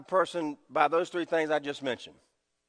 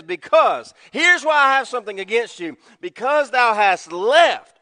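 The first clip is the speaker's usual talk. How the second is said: booming sermon proclamation; preaching loud; 4 semitones higher, 7 dB louder than usual. Acted. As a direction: proclaim it from the pulpit. Preaching at full force.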